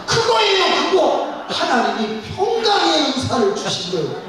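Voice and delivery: very high and loud with a laugh; delighted exclamation. Retold or imitated. Pastor tells congregant she got the gift, elated high cry. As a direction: burst out with triumphant joy.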